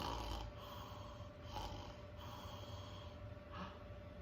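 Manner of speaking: soft, startled intake of breath; quiet suspenseful gasp; hushed gasp. A narrator's reading. Bears hear noise upstairs.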